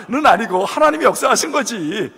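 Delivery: words broken by laughter; laughing while talking. Real, not acted. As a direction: keep laughing as you tell it. Laughing through the story. (웃으면서 말하기)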